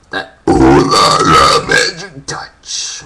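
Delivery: slow low burped phrase; talking while burping. Shown as a demonstration. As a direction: burp the words out slowly. Burping vlog.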